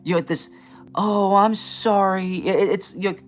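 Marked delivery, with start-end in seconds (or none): 0.9-2.4 slow, high mock-apologetic sigh; a hint of a pitying reader. Mimicking condescending readers, slow mock-apologetic pity. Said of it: "they switch on this".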